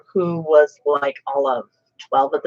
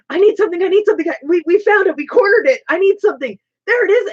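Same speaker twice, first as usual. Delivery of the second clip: high-pitched, imitated panic; retold frantic urgency; 10 semitones higher, 3 dB louder, faster than usual. Imitating cousin running out to find something, frantic and high. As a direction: blurt it out, imitating a frantic search.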